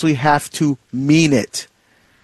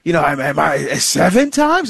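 astonished "seven times?" pitched way up; incredulous. Retold or imitated. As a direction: act shocked at how big "seven" is. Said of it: "he thought he was pushing it when he said seven".

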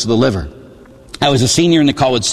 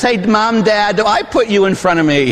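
put-on higher, eager kid asking his parents; a child asking Mom and Dad, a bit higher; pleading child; 6 semitones higher than usual. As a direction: ask it like a hopeful child. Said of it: "You ask your parents".

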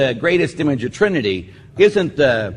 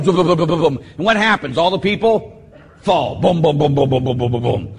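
imitated thuds; 2 semitones higher than usual. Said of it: "people falling".

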